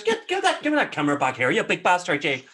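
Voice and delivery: loud, high, outraged Northern Irish Bigfoot; Northern Irish accent. Acted. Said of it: "he's, like, Northern Irish".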